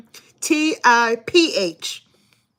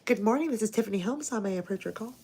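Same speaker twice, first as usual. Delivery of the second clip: softer, quick receptionist phone voice; 11 dB quieter, much faster than usual. Retold or imitated. Streamer imitating Tiffany as a secretary, soft receptionist voice. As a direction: answer the phone like a front desk. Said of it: "She'd be like".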